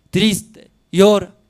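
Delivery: high, loud stressed words; impassioned preaching. Acted. Preacher describing the weeping mother, loud and high. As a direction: cry out the mother's grief.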